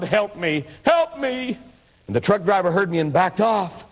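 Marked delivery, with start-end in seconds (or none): 0.0-0.6 first plea, close to his own voice; an understated plea, not yet screaming; retold cry for help. Pinned under the log truck, a mild first cry for help. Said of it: "And I screamed". 0.8-1.6 a mock cry climbing high in panic; retold scream for help. Under the truck wheels, crying to God, pitched high. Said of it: "And I screamed".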